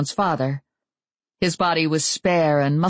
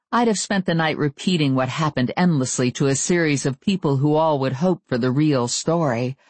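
plain reading voice

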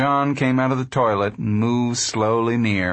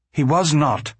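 mildly indignant, higher-pitched retort; angry; 4 semitones higher than usual. A narrator's reading. Ma defending the manager to Pa, angry. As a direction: snap back in defence, without shouting. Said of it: "Ma cried angrily".

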